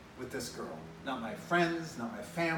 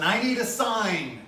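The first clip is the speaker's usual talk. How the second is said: crying out; 6 semitones higher, 7 dB louder, slower than usual. Retold or imitated. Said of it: "I cried out to God".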